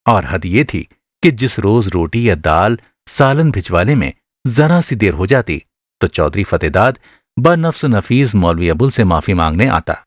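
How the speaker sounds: ordinary talk, nothing marked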